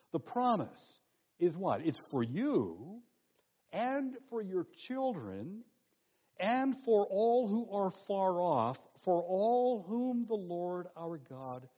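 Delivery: slow, deliberate preaching emphasis, each phrase weighed; emphasized phrase. Shown as a demonstration. Preacher stresses the promise to the congregation, slow and weighty. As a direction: lean on every phrase of the promise, slowly. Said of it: "Now notice this phrase".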